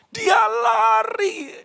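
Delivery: slow, high shouted call of his escape; dramatic flight. A narrator's reading. Preacher telling of Sisera fleeing, shouted high and slow. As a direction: stretch it out like a chase.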